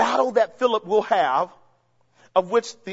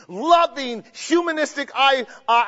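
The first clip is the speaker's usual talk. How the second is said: ordinary talk, nothing marked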